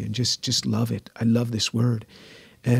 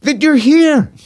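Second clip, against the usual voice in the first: much higher and louder, a laughing exasperated mother; 10 dB louder, slower than usual. Retold or imitated. Mother exasperated at her son in church, high laughing scold. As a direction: play his mother scolding him with a laugh.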